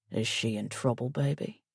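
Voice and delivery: soft words like a caress. A narrator's reading. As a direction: soothe her quietly. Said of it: "His soft words are like a caress".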